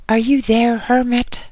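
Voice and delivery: high-pitched yell from the cave mouth. A narrator's reading. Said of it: "yelled a high-pitched voice".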